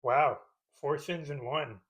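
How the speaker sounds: normal talking voice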